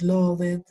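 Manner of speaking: "loved" in two syllables, lo-vet; wrong pronunciation. Shown as a demonstration. Teacher showing what not to say, mispronounced. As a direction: sound the e in "loved". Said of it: "mucho menos".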